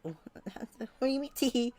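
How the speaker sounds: high, slowed laughing "What do you mean, tee?"; laughing disbelief. Acted. Laughing disbelief at soul pun.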